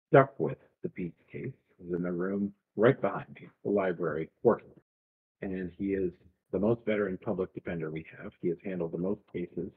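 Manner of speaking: calm stern official; stern judge. Acted.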